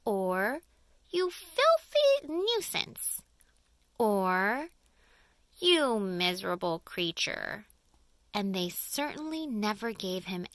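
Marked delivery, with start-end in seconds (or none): 1.1-3.2 much higher, louder and slow shrill jeer; slow, high, louder mocking aunt's sneer; shrieking aunt. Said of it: "referred to him as". 5.6-7.7 sneering aunt. Acted. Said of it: "referred to him as".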